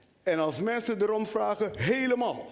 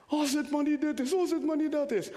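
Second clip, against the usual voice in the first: higher, pleading worried patient; anxious patient; 6 semitones higher, faster than usual. Retold or imitated. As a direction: fret higher, as if dreading the diagnosis. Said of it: "Je bent hartstikke bezorgd".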